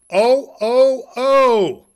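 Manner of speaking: very high, loud jolly laugh; Santa's ho ho. Pretend. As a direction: belt out a backwards Santa laugh. Joke: Santa backwards laugh.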